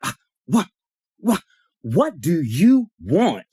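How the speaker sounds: drawn-out, high, exasperated mimicry; mock exasperated "what do you want"; annoyed rebuff. Acts out annoyed woman's rebuff.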